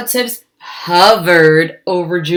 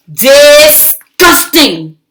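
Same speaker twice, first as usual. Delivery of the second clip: slow, loud drawn-out disgust; 7 semitones higher, 8 dB louder, much slower than usual. Acted. Lily reacting to her parents' gushing, slow and disgusted. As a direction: drag the word out in revulsion.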